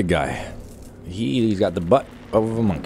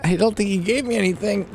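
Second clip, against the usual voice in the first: normal talking voice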